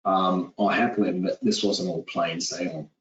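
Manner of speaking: ordinary talk, nothing marked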